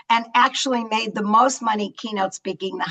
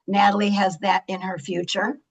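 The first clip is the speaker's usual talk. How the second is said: usual speaking voice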